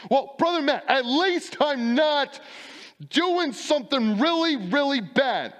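high, slow mock whine of a self-justifying churchgoer; whiny excuse. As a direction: pitch it way up and plead like a defensive member. Congregant excuse voiced by preacher.

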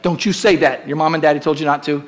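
ordinary talk, nothing marked

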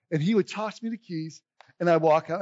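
normal talking voice

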